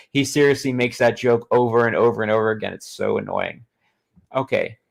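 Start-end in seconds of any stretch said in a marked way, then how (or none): none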